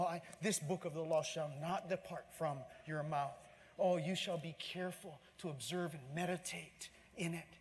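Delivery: ordinary delivery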